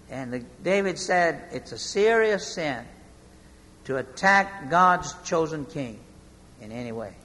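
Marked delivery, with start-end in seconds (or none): 1.5-7.1 much softer, low and fading; solemn quiet. Retold or imitated. David to his men, solemn and quiet. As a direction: say it gravely and hushed.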